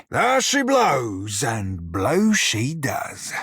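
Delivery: drawn-out tease. Acted. Lewd pirate joke.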